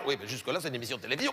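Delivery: low, soft director's reply. Acted. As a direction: drop low and quiet to play the director. Le directeur objecte au créatif. (directeur de télé, grave et bas)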